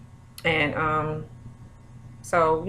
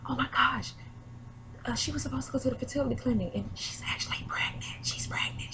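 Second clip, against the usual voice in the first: hushed, hurried gossip whisper, higher pitched; excited whisper; 8 semitones higher, 9 dB quieter than usual. Retold or imitated. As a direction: whisper it like a secret that is too exciting to keep. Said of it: "So she whispering".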